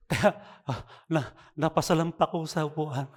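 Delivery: usual speaking voice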